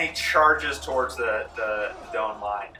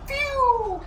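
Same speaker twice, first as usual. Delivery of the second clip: startled yelp; 3 dB louder than usual. Acted. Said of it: "and the guy goes".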